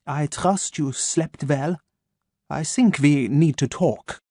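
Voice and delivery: put-on slow, crisp German-accented menace; measured German doctor, each word placed; a calm German voice waking his captive. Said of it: "his German accent crisp and sharp".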